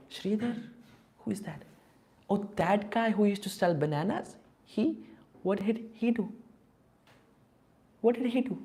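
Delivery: high-pitched, puzzled disbelief; mock astonishment, pitch leaping up on questions; incredulous. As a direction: play baffled onlookers questioning the choice. Acts out devotees' incredulous questioning.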